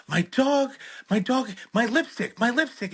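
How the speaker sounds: put-on high, loud panicked pleading with a foreign accent; hysterical caller. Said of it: "This woman's hysterical".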